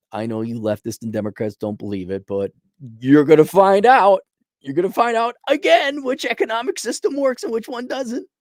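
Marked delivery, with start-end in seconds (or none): 3.0-4.2 stern warning. Real, not acted. Warning to leftists, repeated louder. 4.6-8.3 sing-song it up high to taunt the leftists; mocking high warning. Real, not acted. Repeats the warning, rising higher.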